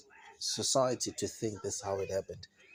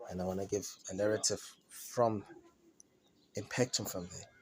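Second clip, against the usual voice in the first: ordinary talk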